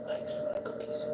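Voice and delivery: whispered to the cat. Real, not acted. Whispering to the cat about cookies. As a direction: breathe it softly.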